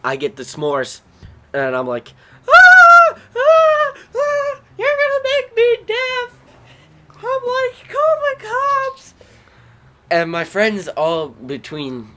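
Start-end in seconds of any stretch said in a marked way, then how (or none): 2.5-9.1 very high, loud shrieks; shrieking. Retold or imitated. Kid shrieking for help during a fight, very high. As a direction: scream high and frantic, slow at the end.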